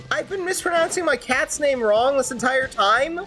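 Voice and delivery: high-pitched incredulous question; shocked realization. Real, not acted. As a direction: blurt it out in sudden disbelief. Realizes cat's name was mispronounced.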